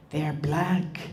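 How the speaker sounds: a quiet, awed asking; reverent question. Retold or imitated. Grandmother asks the children, hushed and reverent. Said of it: "like a reverent question".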